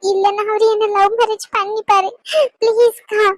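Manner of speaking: sobbing between begging phrases; tearful pleading. Acted. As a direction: beg through tears, breaking off for sobs. (அழுதுகொண்டே கெஞ்சும் குரல்)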